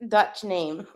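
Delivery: ordinary delivery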